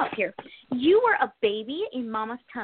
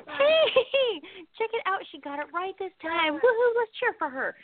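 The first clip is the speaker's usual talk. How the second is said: high, bright-eyed mock cheer with a laugh; 8 semitones higher, slower than usual. Mocking her fans, playful high hammed-up cheer. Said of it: "take a deep breath and go".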